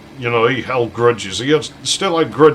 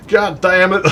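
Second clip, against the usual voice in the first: higher, louder exasperated swearing; frustrated curse; 7 semitones higher, 4 dB louder than usual. Retold or imitated. Friend cursing the US plug that won't fit, frustrated outburst. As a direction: snap it at the useless plug.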